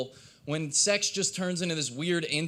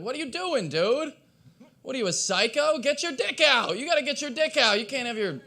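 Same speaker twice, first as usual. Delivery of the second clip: high-pitched indignant protest; exasperated high-voiced heckle; incredulous outburst; 11 semitones higher, 4 dB louder than usual. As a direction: pitch it way up, scolding in disbelief. Acted heckle of a clothed choker.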